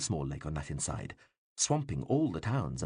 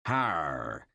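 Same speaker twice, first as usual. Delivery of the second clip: mock rustic bark; hammed-up rustic; 4 semitones lower than usual. A narrator's reading. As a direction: overplay a country bumpkin's exclamation. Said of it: "in the voice a rather unsubtle actor might employ when asked to impersonate a worthy rustic".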